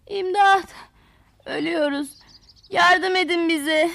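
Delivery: acted wail of a dying storybook flower; crying for help. Wilting flowers begging the tools to save them, crying out. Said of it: "diye bağırışmaya başlamışlar".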